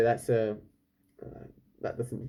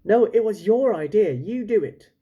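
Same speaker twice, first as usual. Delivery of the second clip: higher, bickering back and forth; squabbling fishermen; 9 semitones higher, 5 dB louder than usual. Acted. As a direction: pass the blame like squabbling kids. Fishermen squabble over who asks.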